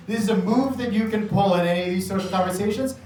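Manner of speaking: ordinary talk, nothing marked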